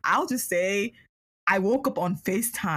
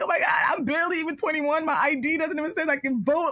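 freaking out; 8 semitones higher than usual. Retold or imitated. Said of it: "I was freaking out".

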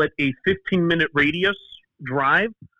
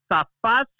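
higher-pitched rapid-fire mock telling-off; imitating scolding Greek mom; 8 semitones higher than usual. Mom catching son playing before homework, imitated scolding. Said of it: "Why are you guys yelling at each other?".

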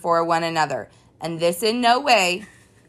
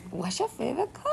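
mock high voice; 8 semitones higher, 9 dB quieter, faster than usual. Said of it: "shallow conversations of".

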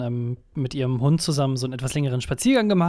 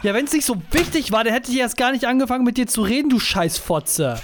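angry retort; 7 semitones higher than usual. Retold or imitated. Imagined angry reply to girlfriend.

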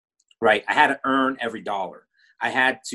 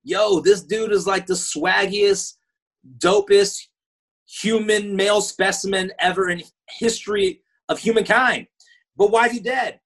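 excited; 8 semitones higher than usual. Retold or imitated. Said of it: "I'm like".

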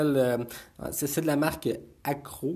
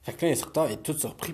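usual speaking voice

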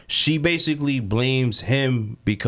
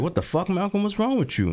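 mock bewildered protest; 7 semitones higher than usual. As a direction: imitate someone baffled and offended. Imagined shocked reaction to an impostor.

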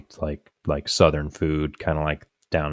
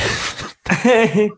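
burst of laughter; 4 dB louder than usual. Real, not acted. Laughing after his joke.

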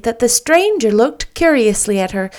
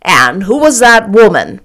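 stern Marilla; 7 dB louder than usual. Acted.

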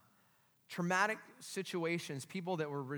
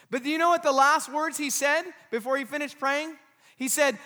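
loud, high, driving sermon delivery; raised-voice pulpit storytelling; full-force preaching; 9 semitones higher, 11 dB louder than usual. Real, not acted.

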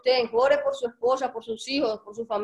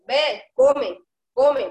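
louder, firm order to a dog; firm command; 6 dB louder, much slower than usual. Acted. Owner ordering the German shepherd to eat, firm command. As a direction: order it with authority, punched. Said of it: "Entonces, ella me obedece".

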